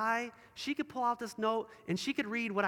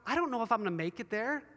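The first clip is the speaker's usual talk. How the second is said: ordinary talk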